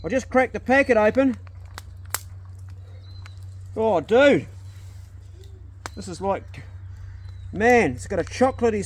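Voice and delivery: thrilled swearing at the smell; excited. Real, not acted.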